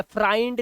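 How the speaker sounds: "फ्राइंड" with an eye sound, rhyming with kind; wrong "eye" vowel. Shown as a demonstration. Teacher shows learners what not to say, "फ्राइंड" with eye vowel. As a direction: mispronounce friend as "फ्राइंड". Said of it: "नहीं बोलते sir".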